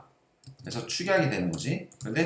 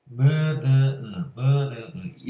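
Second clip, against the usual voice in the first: nasal hum with pinched nose; pinched nose, humming slowly; pinching nose; much slower than usual. Shown as a demonstration. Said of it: "얘네 울려".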